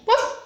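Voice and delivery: short, high bark; dog woof. Acted. Dog has found a bone, short high woof. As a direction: yip like a small dog.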